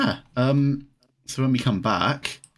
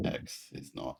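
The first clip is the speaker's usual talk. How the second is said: muttered aside; 16 dB quieter, slower than usual. Real, not acted.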